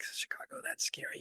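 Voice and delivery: whispered as if the city might hear; hushed warning. Retold or imitated. Kind lady warning travelers about Chicago, whispered. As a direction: drop to a scared whisper on Chicago.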